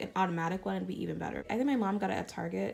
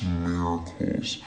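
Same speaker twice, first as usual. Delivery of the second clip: low, slow, dramatic voice; dramatic deep voice; 7 dB louder, much slower than usual. Pretend. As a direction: drop into a deep dramatic drawl. Dramatic praise of concealer.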